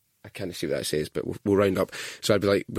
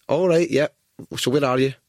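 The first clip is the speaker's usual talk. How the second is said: louder, higher dispatcher voice; taxi operator; 6 semitones higher, 4 dB louder than usual. Retold or imitated. Taxi operator to a deaf old caller, raised voice. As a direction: speak up for a customer who can't hear. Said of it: "they'd be like".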